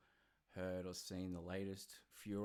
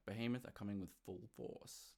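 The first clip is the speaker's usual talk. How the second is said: ordinary delivery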